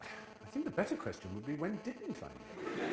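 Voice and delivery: high, slow laughing answer; amused mother. Retold or imitated. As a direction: tease him gently, laughing.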